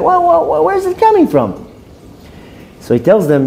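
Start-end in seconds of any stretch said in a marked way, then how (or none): 0.0-1.5 high, stammering outraged disbelief; indignant disbelief. Acted. Gabbai sputters at the rabbi in high indignant disbelief. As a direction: sputter like an offended congregant who can't believe it.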